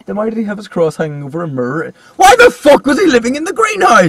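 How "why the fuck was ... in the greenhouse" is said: very high, loud outburst; exasperated shout. Real, not acted. Attacking the film's plot to a co-host, exasperated shout. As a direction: yell it in sheer disbelief.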